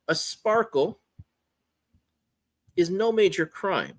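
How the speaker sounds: usual speaking voice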